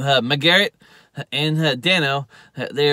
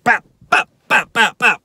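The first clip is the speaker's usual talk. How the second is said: loud, high popping shots; imitated gunshots; 8 semitones higher, 8 dB louder than usual. [name] is shooting the guys, popped gunshot noises. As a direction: fire off short bursts with the mouth.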